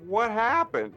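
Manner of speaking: high, loud mock outrage; imitated director's yell. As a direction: holler it like an exasperated director. Director's retold outburst.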